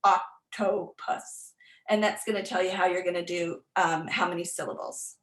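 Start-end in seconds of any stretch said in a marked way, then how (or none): 0.0-1.5 "octopus" slowly parted into oc-to-pus; syllable by syllable. Shown as a demonstration. Teacher shows chin-drop counting, "octopus" in slow separate syllables. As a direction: give each syllable of "octopus" its own beat. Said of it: "I would just stick with this".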